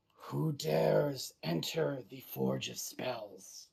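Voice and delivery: slow, eerie monster voice in the mind; raspy voice. Acted. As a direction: rasp it out slowly like an ancient beast. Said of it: "in a raspy voice that you only hear in your head".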